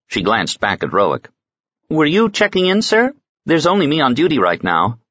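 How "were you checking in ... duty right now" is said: higher, eager clerk voice; a young clerk rising high on the question. Acted. Desk clerk asks Roic if he's checking in, high and eager.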